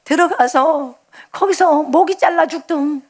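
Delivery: retold tearful plea to the Lord; crying promise. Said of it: "막 이랬어요".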